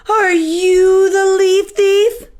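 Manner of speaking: high, slow indignant question; suspicious accusation. Acted. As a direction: squirrel suspecting his friend.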